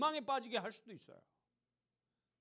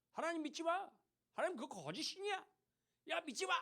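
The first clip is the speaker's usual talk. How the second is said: higher, sneering taunt of the enemy king; mocking taunt; 7 semitones higher, 3 dB quieter than usual. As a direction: jeer it like a scoffer. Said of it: "이렇게 조롱하고 있는 거지". (높은 목소리로 비웃듯 조롱하며)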